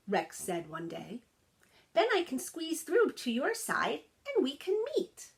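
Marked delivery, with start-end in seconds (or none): 1.9-5.3 put-on eager young dog. A narrator's reading. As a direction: chirp it out like a friendly little pup. Rex announces a plan.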